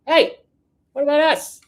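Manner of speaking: high, loud cry of Hey what about us; mock indignant citizen. Russian people demanding attention, high indignant call. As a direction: voice a fed-up crowd shouting up. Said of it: "the Russian people are gonna be like".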